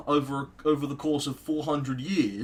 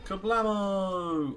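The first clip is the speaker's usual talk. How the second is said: drawn-out gleeful shout; 5 semitones higher, much slower than usual. Real, not acted.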